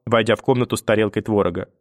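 ordinary delivery, nothing marked

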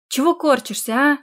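mildly irritated challenge. A narrator's reading. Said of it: "повысила тон Тия".

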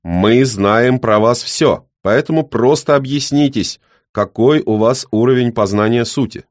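put-on cold interrogator old man; stern old man.

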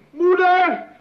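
loud, high call for mother; outraged call. Acted. Son calls his mother, loud and dismayed. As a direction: shout for her in dismay.

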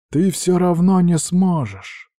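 lightly voiced older brother, unimpressed; tired, unbothered drawl of a schemer; dragon Azul, weary. A narrator's reading. Azul's voice in dialogue.

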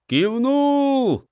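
high, echoing falconers' reply; echoing chorus. A narrator's reading. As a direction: echo it back like a crowd. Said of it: "эхом отозвались сокольники".

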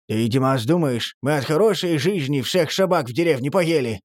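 usual speaking voice

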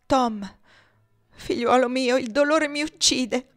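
supplica affranta, più alta e più forte; il dolore che trabocca nella voce; anguished plea. A narrator's reading. Padre affranto si rivolge a Tom. (il padre affranto si rivolge a Tom, straziato)

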